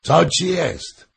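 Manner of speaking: loud, high, drawn-out question; big-voiced royal demand; king shouting. A narrator's reading. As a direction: cry out the question, slowly and high. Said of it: "krzyknął król".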